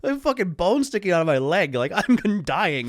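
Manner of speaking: high, loud, laughing disbelief; incredulous. Retold or imitated. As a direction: re-enact a comic protest at useless help. Retold disbelief at single aspirin.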